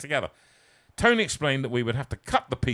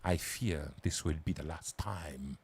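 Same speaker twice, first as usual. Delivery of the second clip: put-on slow, low and soft European villain accent; generic European villain accent; 11 semitones lower, 12 dB quieter, slower than usual. Villain taunting Bond, in a European accent. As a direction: menace him softly in a European accent. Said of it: "in a generic European accent".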